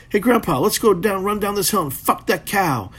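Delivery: keen youngster itching to run downhill; eager baby bull. Retold or imitated. Baby bull urging grandpa, lightly voiced eager youngster. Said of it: "little baby cow goes".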